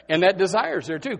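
ordinary delivery

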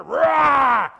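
fast, rising, then a loud roar; bear roar. Acted. Preacher acting out the bear charging David with a roar.

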